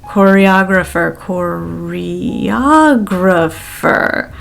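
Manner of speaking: very slow, each syllable drawn out; slow drilled syllables. Shown as a demonstration. Teacher drilling slowly, syllables stretched. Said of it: "slow it down again".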